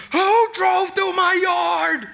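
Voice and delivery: high, loud, slow furious yell; livid dad shout. Retold or imitated. Her dad storming in about his ruined yard, furious shout. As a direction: roar it like an enraged father at the door. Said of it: "he was livid".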